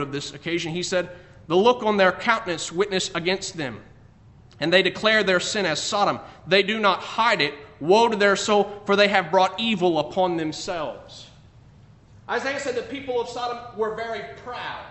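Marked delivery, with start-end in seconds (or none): 1.5-11.3 loud, preached Bible reading; scripture reading. Acted. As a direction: proclaim the verses to the congregation. Quotes Isaiah loudly.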